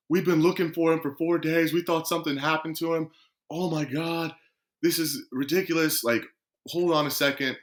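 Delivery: retold frantic relief. Agent on the phone learns the missing player turned up, retold frantic relief. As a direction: imitate someone worked up after days of searching.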